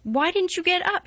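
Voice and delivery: Mary's high, sharp question; stiff demand. A narrator's reading. Mary confronting sulking Colin, stiff and high. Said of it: "marched up to him with her stiff manner".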